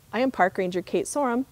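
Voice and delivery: ordinary delivery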